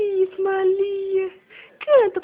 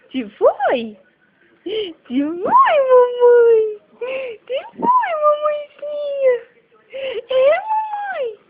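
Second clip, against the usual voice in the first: squeaky praising a dog; 4 semitones higher, 4 dB louder than usual. Real, not acted. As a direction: coo up high like to a puppy. Cooing over baby after mishap.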